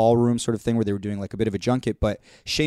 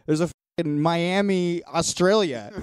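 disbelieving; 6 semitones higher than usual. Retold or imitated. Retold drunk disbelief.

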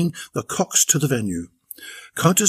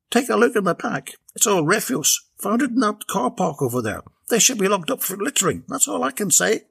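higher, rough bloke voice; gruff character voice; 8 semitones higher than usual. Acted. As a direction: play the cheeky van driver. Des voiced in story.

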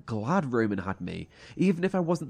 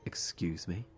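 soft whispered disbelief; stunned whisper; 8 semitones lower, 10 dB quieter than usual. Acted. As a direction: barely voice it, stunned. Elliot shocked by Naya's accusation.

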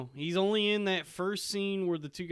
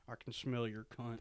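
out of mouth corner; 6 semitones lower, 14 dB quieter than usual. Retold or imitated. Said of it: "That's a good Jodie Foster".

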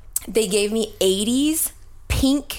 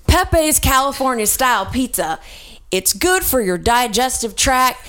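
crazy pitchman; 5 semitones higher than usual. Retold or imitated. Gary pitches pizza to the camera, mock excited ad read. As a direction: sell the pizza straight to the camera, getting carried away. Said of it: "he's talking crazy talk".

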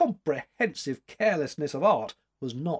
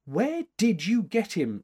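slow, raised-pitch demand; 10 semitones higher, 3 dB louder than usual. A narrator's reading. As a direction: press for an answer, word by word. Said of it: "she demanded".

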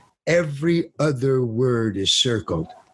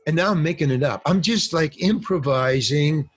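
ordinary delivery

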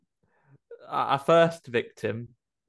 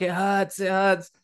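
higher mock cry of pain; mild mock screaming; 6 semitones higher, slower than usual. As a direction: imitate a victim screaming, held back. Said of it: "she's still in there screaming".

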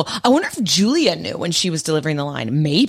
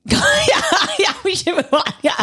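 laughing; 11 semitones higher than usual. Real, not acted. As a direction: burst out laughing, agreeing. Laughing agreement to change subject.

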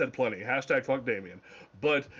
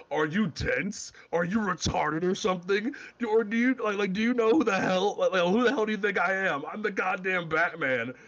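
butchered Batman impression; 5 semitones higher than usual. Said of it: "And I butchered that".

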